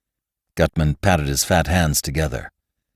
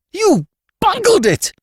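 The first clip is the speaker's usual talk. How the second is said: high, loud, spluttering hysterical shriek; shrill spluttering scream; 6 dB louder than usual. A narrator's reading. Levantine to Gutman, finding the fake, shrill scream. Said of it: "he screamed at Gutman".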